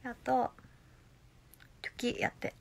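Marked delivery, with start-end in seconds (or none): 1.8-2.6 soft whispered reading. Real, not acted. Reading a viewer request in a whisper. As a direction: read it in a whisper.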